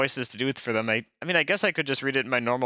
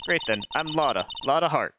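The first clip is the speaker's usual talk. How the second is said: put-on Southern accent. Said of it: "I'm not the best at doing accents".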